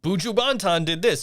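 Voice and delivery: a hint of a bad Jamaican accent; lightly put-on Jamaican accent, half-hearted. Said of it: "I would do a really bad Jamaican accent".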